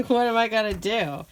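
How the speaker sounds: exasperated laugh. Real, not acted. Exasperated mom laughing at toddler.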